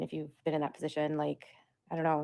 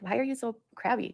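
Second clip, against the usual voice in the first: put-on asking the kid; 7 semitones higher than usual. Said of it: "And we're like".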